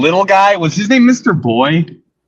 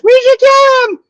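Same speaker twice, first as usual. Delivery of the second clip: put-on squeaky high child voice, much higher, a bit louder; little kid voice; 5 dB louder than usual. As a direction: play a tiny eager kid shouting a line. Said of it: "it's just in his little kid voice".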